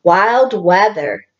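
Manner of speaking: normal talking voice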